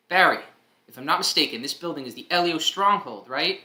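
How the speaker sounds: Zatch's voice. Acted. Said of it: "That's, uh, Zatch talking".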